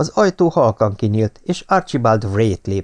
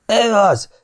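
shrill high screech, much higher and louder; 12 semitones higher, 4 dB louder than usual. A narrator's reading. Feverish Ellen screeching at the secretary. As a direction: screech it out in terror. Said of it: "rikácsolta".

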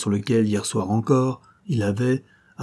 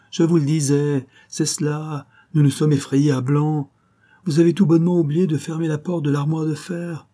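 radiant joy; 6 semitones higher than usual. A narrator's reading. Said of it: "dit-il rayonnant de joie".

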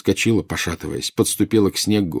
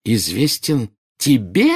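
slow, louder incredulous question; indignant; 5 semitones higher, 5 dB louder, slower than usual. A narrator's reading. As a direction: draw it out in outraged disbelief. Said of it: "сменившись возмущением". (с возмущением, растягивая слова)

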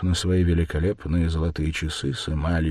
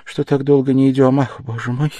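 put-on raised, worried older woman's voice; a mother fussing that her son is offended; anxious mother, higher and louder; fretting mother; 8 semitones higher, 7 dB louder than usual.

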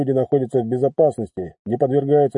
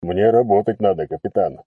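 low, calm, unbothered reply; unperturbed; 5 semitones lower than usual. Acted. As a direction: answer without a flicker. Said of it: "невозмутимый ответ".

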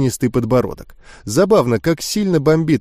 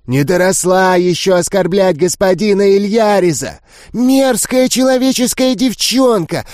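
higher, strange indignant creature voices; ghoulish outrage; 6 semitones higher than usual. Acted. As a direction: berate the human girl furiously. Ghouls scolding the girl. (возмущённые гули, визгливо)

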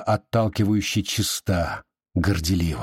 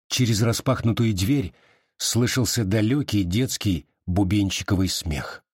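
ordinary delivery, nothing marked

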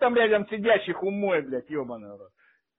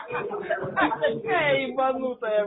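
laughing; 3 semitones higher, 4 dB quieter, much slower than usual. Real, not acted. Laughs at the caller.

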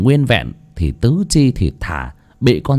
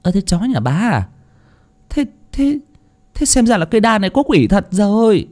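spooked bystander; 11 semitones higher than usual. Acted. Bystander asks Bà Xuân, spooked.

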